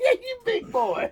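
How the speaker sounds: laughing mock quote. Friend mocks the quoted line.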